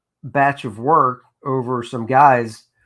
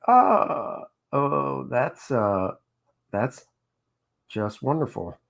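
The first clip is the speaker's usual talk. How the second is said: sarcastic; 5 dB quieter, slower than usual. Retold or imitated. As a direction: pretend to be pleased while clearly fed up. Sarcastic reaction to the lowered rate.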